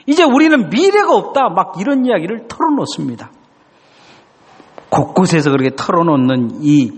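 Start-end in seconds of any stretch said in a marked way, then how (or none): 0.1-1.5 despairing cry. Retold or imitated. Young man's despairing complaint to God.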